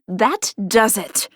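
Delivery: slow, seething resolve; fed up. A narrator's reading. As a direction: say it as the last straw. Henrietta losing her temper.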